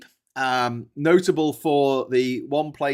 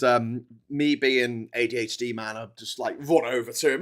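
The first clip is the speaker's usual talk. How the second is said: usual speaking voice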